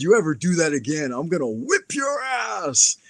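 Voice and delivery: acted-out angry warning, rising sharply; retold threat. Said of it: "I yelled in his face".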